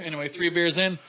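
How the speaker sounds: usual speaking voice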